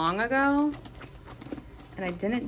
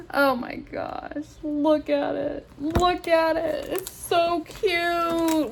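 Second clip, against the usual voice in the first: high, slow, stretched-out cooing; adoring coo; 7 semitones higher, slower than usual. Real, not acted. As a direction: melt over something adorable. Cooing over the picture.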